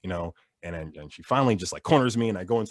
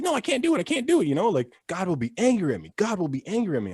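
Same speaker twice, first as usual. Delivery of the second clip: high, fretful church-mom voice; mock mom refusing; 9 semitones higher than usual. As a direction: protest like a worried mother. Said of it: "She's like".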